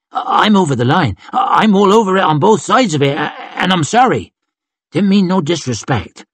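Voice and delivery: fast, high, stammering climb-down; anxious apology. Acted. Robin begs Yon's pardon under threat, nervous and hurried. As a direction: gabble the apology, tripping on the start.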